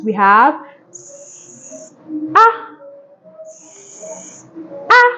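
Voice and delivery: s hissed long, then an open ah; phonics sounds s and ah. Shown as a demonstration. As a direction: sound out s and ah slowly like a teacher. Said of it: "it is pronounced as".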